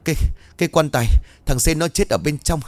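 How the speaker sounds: high, hurried and breathless; frantic, stammering news. A narrator's reading. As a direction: blurt out the bad news. (hốt hoảng, lắp bắp)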